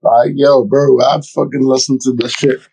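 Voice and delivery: ordinary delivery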